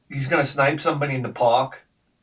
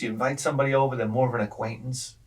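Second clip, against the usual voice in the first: usual speaking voice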